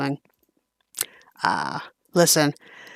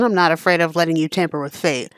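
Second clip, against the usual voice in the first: usual speaking voice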